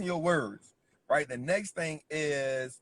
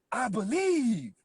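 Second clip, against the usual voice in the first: slow, higher, drawn-out motivational preacher voice; Eric Thomas delivery; 6 semitones higher, 3 dB louder, slower than usual. Retold or imitated. Coach quoting a famous motivational speaker, slow and higher. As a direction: stretch it out like a fired-up motivational speaker. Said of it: "The way, the way he said".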